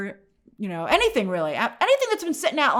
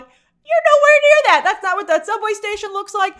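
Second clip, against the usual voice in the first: incredulous scoff; 10 semitones higher, 7 dB louder than usual. Retold or imitated. Reviewer scoffing at the fake subway station, high incredulous. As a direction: squeak it out like it's absurd. Mocking a fake New York scene.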